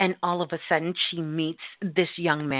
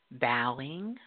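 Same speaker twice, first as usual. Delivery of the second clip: normal talking voice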